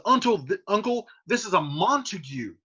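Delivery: high, pressing accusation; warning his uncle of an enemy; urgent Tybalt. Acted. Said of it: "Tybalt says".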